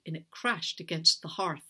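plain reading voice

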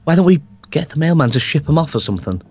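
normal talking voice

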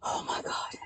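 unvoiced whisper, very soft; hushed little whisper; whispered shock. Real, not acted. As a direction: whisper it to yourself, stunned. Repeats it under breath.

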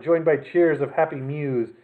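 ordinary delivery

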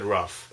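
"rough" for roof, short uh vowel; "rough", with no long oo in it; alternative pronunciation. Shown as a demonstration. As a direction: say roof as "rough", rhyming with "stuff". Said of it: "I really wish I said rough".

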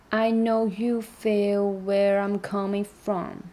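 slow, you and from hit hard; stress on you and from. Shown as a demonstration. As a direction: rap it slowly, punch you and from. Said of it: "这个you和from是比较重的词". (重读you和from)

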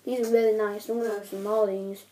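gum in mouth; chewing between words; talking while chewing gum. Real, not acted. Seen 2: just put gum in mouth; jaw and lips show chewing while talking.

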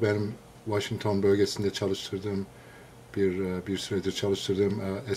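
ordinary talk

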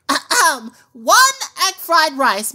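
normal talking voice

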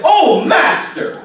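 anguished cry out. Retold or imitated. Servant crying out to his master, an anguished drawn-out cry. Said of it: "that's how he cried out".

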